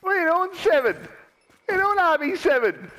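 high-pitched taunting question; taunting call. Real, not acted. Gamer calls out to the rival, taunting.

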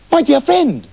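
the doctors crying out high and loud at their thieving friend; exclaimed. Acted. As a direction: cry out in dismay. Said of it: "they exclaimed".

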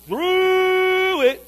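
high, slow, drawn-out; weary groaning call; long drawn-out wail. Acted. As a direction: stretch "through" long. Preacher stretching the phrase.

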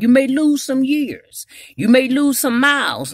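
loud, driving pulpit preaching; preaching at full force. Acted. Preacher warning the congregation off the permissive path, preaching loud. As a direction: lean in and preach it hard to the church.

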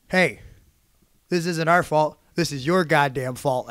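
re-enacted ringside telling-off; retold hoarse accusation. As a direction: pin the blame on them, loudly. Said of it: "in my hoarse voice".

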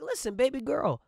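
high, crooning smooth-talk; mock smooth seduction; smooth talker. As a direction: coax her like a lover. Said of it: "I'm like".

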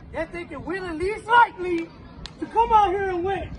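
loud, rising pep shout; rousing shout. Real, not acted. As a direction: fire the team up, shouting. Coach firing up team.

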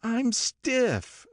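high, drawn-out moan of stiffness; complaining. A narrator's reading. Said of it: "she complained".

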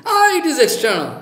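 high, scoffing put-on voice with a laugh; mock the dismissive guru like a clown; mocking joker voice. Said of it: "if I can become a joker and speak like this".

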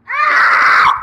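loud gross-out scream; disgusted scream. Real, not acted. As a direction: scream at the awful taste. Disgusting jelly bean tasted.

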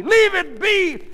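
slow, high, loud command; yelled order. Acted. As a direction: shout at her to stop. Husband yells during tug of sheets.

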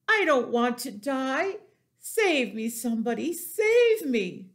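high, loud plea for help; screaming panic. A narrator's reading. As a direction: beg for rescue, rising to a cry. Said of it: "screamed Wilbur".

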